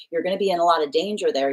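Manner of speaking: usual speaking voice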